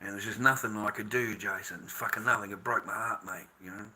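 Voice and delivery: low, hushed admission of helplessness; heartbroken, resigned. Real, not acted. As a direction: let the voice sink, quiet and defeated. Says it broke his heart.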